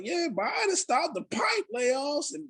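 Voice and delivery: a hint of a child repeating grown-up news; imitating little daughter. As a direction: recite it like a small kid explaining. Quoting Kaylin the daughter.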